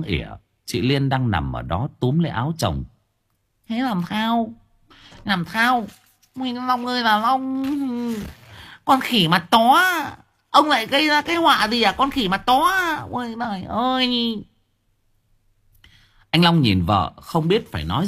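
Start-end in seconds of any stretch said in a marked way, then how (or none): 3.7-14.4 much higher, nagging wife's voice; shrill wife. A narrator's reading. Wife scolds her husband Long, high and wailing. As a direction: wail at him like a scolding wife.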